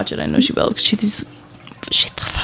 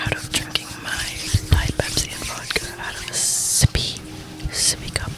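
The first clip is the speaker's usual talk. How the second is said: ASMR whisper; 8 semitones lower, 8 dB quieter than usual. Pretend. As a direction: whisper each little action into the mic. Narrates drinking in ASMR whisper.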